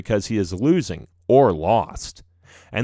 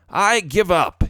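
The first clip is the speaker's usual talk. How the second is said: higher exasperated outburst; exasperated professor; 7 semitones higher, 4 dB louder than usual. A narrator's reading. Professor quitting on his formula, exasperated. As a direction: throw in the towel, pitched up. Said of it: "throwing his hands up in the air".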